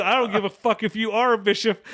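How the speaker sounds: laughing while retelling the cursing; mock angry retort. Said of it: "I said".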